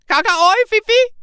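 very high, loud squawked bird words; bird language. Acted. Parrot showing the doctor bird language, very high and loud squawk. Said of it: "But hear this".

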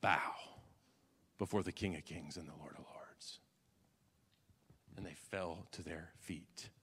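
sudden low, soft whisper; awed hushed reverence. Acted. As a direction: drop to a hush. Dramatic drop to whisper.

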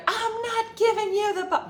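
high, loud furious refusal; angry refusal. Retold or imitated. Ty refusing to hand over the boxes, angry and high. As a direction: shout the refusal, cut off mid-word. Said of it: "real angry with the skeleton".

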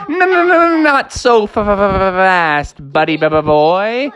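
high, fast no no no stuttering; pleading goat. Acted. Billy Goat begs Froggy to wait, high shivering stutter. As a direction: beg him not to leave, teeth chattering.

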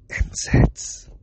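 gasp, then whisper "N saids" as if it were news; stunned whisper. Retold or imitated. Realizing he mispronounced it, he whispers "N saids" in shock.

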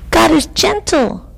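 high, light bird voice; robin's chirp. A narrator's reading. Robin answering the lion, high chirping. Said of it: "chirped the robin".

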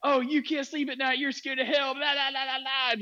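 high-pitched mocking imitation, running into blahs; mock attacking rant. Host mimics the atheist going on a diatribe, mock attacking. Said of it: "jumping down my throat".

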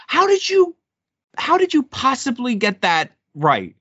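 high, disbelieving voice; amazed. Retold or imitated. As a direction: marvel at how she got it. Retelling his amazement at girlfriend.

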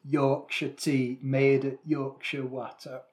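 slow, drawn-out Yorkshire accent. Shown as a demonstration. Co-host asks for a Yorkshire accent, slow Yorkshire attempt. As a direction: have a go at Yorkshire, taking it slowly. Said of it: "can you do a Yorkshire accent?".

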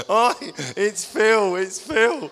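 high, loud imitation of excited children; kids' excited cries. Kids spotting their friend dressed as Santa, excited mock shouts. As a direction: mimic kids calling out in gleeful recognition. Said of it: "other kids were going".

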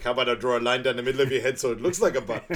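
loud, quick movie-quote taunt; mock taunting threat. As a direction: fire back a comic threat at speed. Acted taunt in a quarrel.

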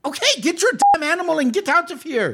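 high, cross order; exasperated matron. Acted. Matron ordering dog removed, exasperated. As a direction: snap at them in a high voice.

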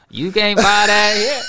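high, loud put-on Atlanta accent; mock Atlanta street accent. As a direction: ham up the Atlanta street talk.

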